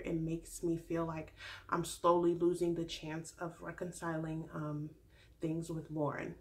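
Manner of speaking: a hint of a nervous fiance confessing; retelling Arie. Vlogger voicing Arie's halting breakup confession, low and soft. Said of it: "he basically just says".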